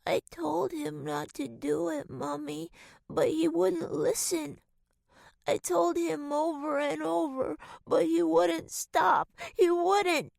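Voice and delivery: put-on a frightened child pleading her innocence; whimpering child. A narrator's reading. Little girl to her mother, whimpering, high and pleading. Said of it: "her daughter whimpered between sobs".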